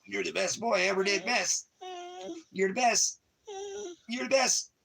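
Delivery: very high squeaky pet talk; high baby voice. Acted. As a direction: squeal with love at the puppy. Coos at the dog.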